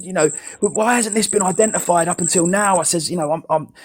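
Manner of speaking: retold angry demand, pitched high; losing it. Carer confronting the doctor over a late finding, high-pitched retold anger. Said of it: "I lost it with the doctor".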